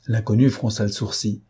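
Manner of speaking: ordinary delivery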